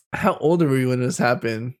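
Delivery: normal talking voice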